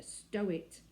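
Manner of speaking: ordinary delivery, nothing marked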